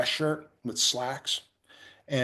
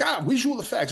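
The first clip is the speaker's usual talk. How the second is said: high-pitched accented stand-in impression; comic accented imitation, pitched way up; Dieter; 9 semitones higher than usual. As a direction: do a quick burst of the stand-in's voice. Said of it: "I can imitate Dieter".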